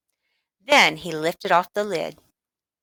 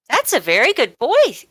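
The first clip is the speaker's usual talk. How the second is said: high, louder praising voice; mother praising; 7 semitones higher, 5 dB louder than usual. Acted. Mother praising her son for taking tonic, high and pleased. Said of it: "Missus Midas said".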